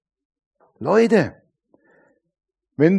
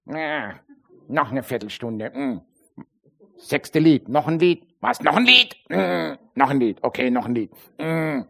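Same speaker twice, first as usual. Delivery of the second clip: softer, weary grumbling at yet another song; mock bored sighing; 3 dB quieter than usual. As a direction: act out a churchgoer sick of singing. Bored churchgoer during worship. (gelangweiltes, genervtes Murren)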